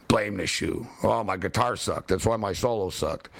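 very low, monotone mock whine; mocking excuse. Ridiculing the player who blamed his shoe, low mocking excuse. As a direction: drop way down to parody a lame excuse.

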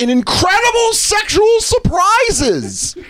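high, hammed-up promo voice; mock ad read. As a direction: announce it like a cheesy promo. Reading ad copy mockingly.

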